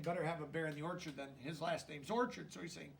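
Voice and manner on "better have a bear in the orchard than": fast, softer explaining aside; quick quiet paraphrase. Shown as a demonstration. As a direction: rattle it off to explain the joke.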